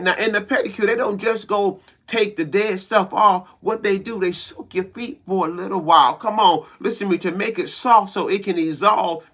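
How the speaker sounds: loud, driving sermon delivery, pushing every line; fired-up motivational preacher; preaching at full force. Acted. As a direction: preach it to the congregation like a revival. Preaching hard to the queens.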